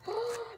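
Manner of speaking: a thrilled intake of breath; delighted gasp. Real, not acted. Feeling the squishy.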